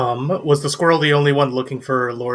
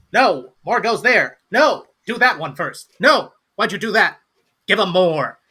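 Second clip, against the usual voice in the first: higher, scolding little overseer; bossy gnome; 6 semitones higher, 4 dB louder than usual. Acted. Gnome supervising the half orc pouring drinks, bossy scolding. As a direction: correct the bartender with impatient, punchy orders. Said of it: "there's a gnome who's kinda, like, looking over them".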